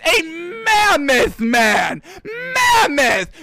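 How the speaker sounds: marvelling at Derrick Henry's size; awed emphasis. Real, not acted. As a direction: stretch out Mammoth in awe.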